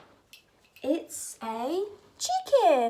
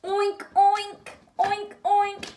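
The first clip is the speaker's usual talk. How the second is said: pig noise; 4 semitones higher, 4 dB louder than usual. Pretend. Making pig noise for toy.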